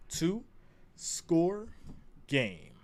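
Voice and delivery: "Two-score" stretched with a rising tune, then "game" dropped; drawn-out "Two-score". Shown as a demonstration. Stretching "Two-score" for the stream viewers. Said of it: "repeated drawn out".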